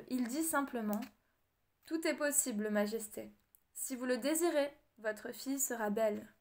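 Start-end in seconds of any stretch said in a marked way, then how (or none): none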